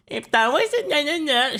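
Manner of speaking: high, drawn-out mocking mimicry; mocking imitation. Mimics the woman from the clip.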